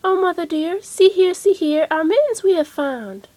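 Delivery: high, eager kitten voices; excited kittens. Acted. Kittens show mother their mittens, high eager voices. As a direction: call out proudly like kittens.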